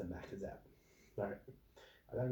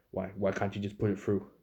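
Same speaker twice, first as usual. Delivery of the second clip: raised, demanding complaint; mock customer whine, louder; 7 dB louder than usual. Customer arguing.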